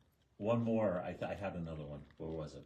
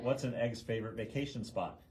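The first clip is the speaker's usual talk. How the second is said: ordinary talk, nothing marked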